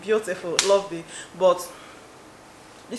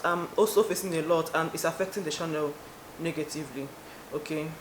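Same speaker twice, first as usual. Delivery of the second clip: subdued; 3 semitones lower, 6 dB quieter than usual. Real, not acted. Admits channel struggles.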